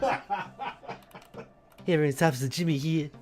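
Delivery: lightly voiced funny officer greeting; silly character voice on the edge of laughter; a hint of a goofy cop; Officer Jimmy. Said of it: "every time I do his opening".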